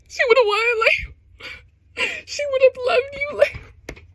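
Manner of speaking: high, wailing, half-crying squeal; real fangirl wail; emotional squeal. Real, not acted. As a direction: squeal it out, overcome with feeling. Overwhelmed fan reacting to scene.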